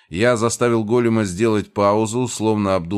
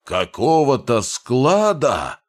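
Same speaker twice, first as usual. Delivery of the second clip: slow, furious roar; 5 semitones higher, 4 dB louder, slower than usual. A narrator's reading. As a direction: bellow it back in rage. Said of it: "взревел он".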